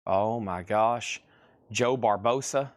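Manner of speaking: normal talking voice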